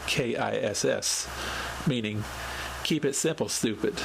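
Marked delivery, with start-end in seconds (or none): none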